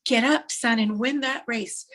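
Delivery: acted heartfelt rallying cry from a father; every ounce of emotion. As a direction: cry out, then drive each word home. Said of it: "with every ounce of emotion he can".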